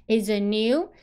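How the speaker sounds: "is it new" lifting at the end; rising question tune. Shown as a demonstration. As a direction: ask "is it new" with the voice going up on "new". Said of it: "疑问句的语气都要上升调子". (“is it new”句末用升调)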